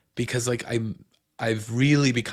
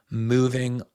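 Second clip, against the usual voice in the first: normal talking voice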